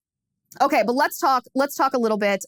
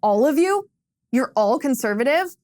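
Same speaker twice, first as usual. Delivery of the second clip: mock surprise; 3 semitones higher than usual. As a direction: act shocked that everyone is conservative. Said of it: "and she goes".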